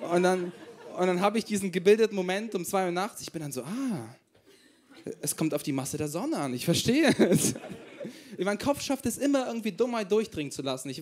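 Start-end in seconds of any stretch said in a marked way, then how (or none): none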